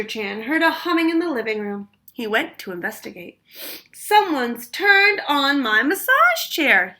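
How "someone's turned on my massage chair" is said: slow, loud outraged bellow. A narrator's reading. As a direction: boom it out slowly. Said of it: "he bellowed".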